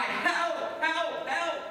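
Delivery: high, repeated call for help; mock cry for help. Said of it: "heard her cry".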